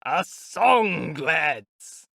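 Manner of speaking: loud, festive shout; rally the cart to sing; rowdy call. A narrator's reading. A reveller calls the cart to sing, rowdy shout. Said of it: "someone shouted from the cart".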